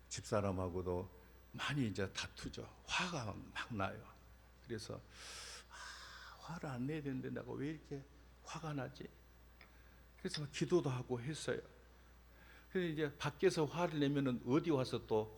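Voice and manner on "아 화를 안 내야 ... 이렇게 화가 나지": soft, half-whispered self-reproach; 혼잣말하듯 작게 자책하며; muttered self-talk. Retold or imitated. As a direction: grumble it to yourself under your breath. Inner self-reproach about anger.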